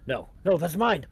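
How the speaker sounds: loud, rising protest; alarmed, possessive outcry; desperate objection. Acted. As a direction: object urgently, pitch jumping up. Man objects as materials are seized.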